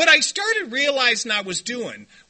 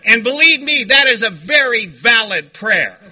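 emphatic; 8 dB louder than usual. Real, not acted. Emphatic conclusion to audience.

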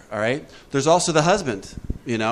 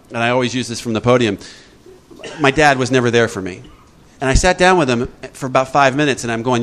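usual speaking voice

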